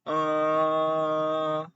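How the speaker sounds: level high held uh; first tone demo. Shown as a demonstration. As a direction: hold "Uh" flat and high like Mandarin tone one. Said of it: "the first tone is high pitch".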